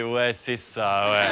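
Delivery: très lent, incrédule, moqueur; un ouais traînant qui n'y croit pas; vendeur sarcastique. Acted. Seller mocks customer's request. (le vendeur répond au client, sarcastique et traînant)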